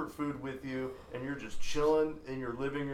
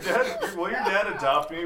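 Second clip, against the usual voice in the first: normal talking voice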